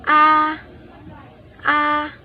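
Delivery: "A" held high and level, first tone. Shown as a demonstration. Teacher demonstrating first tone to viewers, high level "a". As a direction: keep "a" high and flat, steady pitch. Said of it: "第一声".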